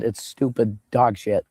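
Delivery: ordinary delivery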